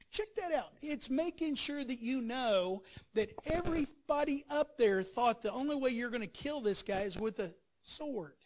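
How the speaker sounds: normal talking voice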